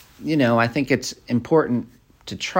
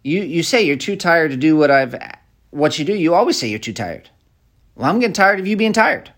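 unwholesome complaint; 6 semitones higher, 3 dB louder, faster than usual. Shown as a demonstration.

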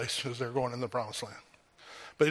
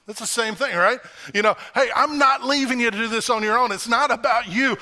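higher, louder, building preaching; fervent preaching; 9 semitones higher, 8 dB louder than usual. Acted. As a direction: drive the promise home to the church. Preaching at full force.

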